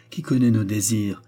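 usual speaking voice

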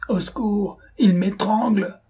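high, drawn-out strangled cry; weakened voice. A narrator's reading. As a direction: call for help as if being choked. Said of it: "murmurait d'une voix affaiblie".

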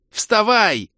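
high, loud frantic order; panicked yell. A narrator's reading. Mage urges the fallen fighter up, panicked yelling. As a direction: scream it in a panic. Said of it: "в панике заорал я".

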